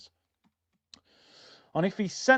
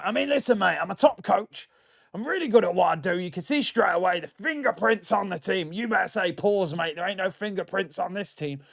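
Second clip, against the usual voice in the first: raised-pitch accent impression of a football manager; Sean Dyche accent; 3 semitones higher than usual. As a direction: put on an English football coach's accent, pitched up and matey. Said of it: "did that in the Sean Dyche accent".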